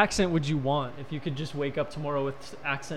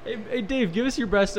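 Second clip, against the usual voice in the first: normal talking voice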